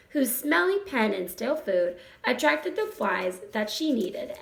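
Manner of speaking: plain reading voice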